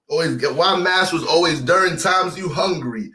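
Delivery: ordinary talk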